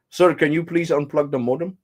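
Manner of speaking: put-on high, polite Indian accent; imitated Indian agent. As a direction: request it like an Indian tech-support agent. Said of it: "That's exactly how he talked".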